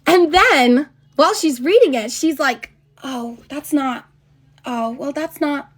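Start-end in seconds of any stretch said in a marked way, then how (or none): 3.0-5.7 softer, halting imitation of someone puzzled; mock hesitant muttering. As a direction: trail off as if finding a mistake while reading. Manager falters while reading paper.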